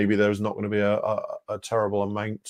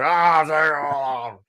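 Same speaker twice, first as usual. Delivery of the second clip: put-on belligerent drunk; 5 semitones higher than usual. Mocking the drunk challenge.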